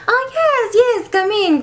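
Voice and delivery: high, swooping gushing welcome; sassy assistant. Acted. Assistant inviting the producers in, high and gushing. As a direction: flutter a high, campy welcome. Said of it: "he's a little sassy".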